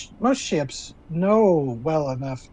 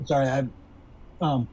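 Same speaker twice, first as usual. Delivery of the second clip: mouth full; 7 dB quieter, faster than usual. Real, not acted. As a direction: still chewing a cookie. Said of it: "I'm eating a cookie".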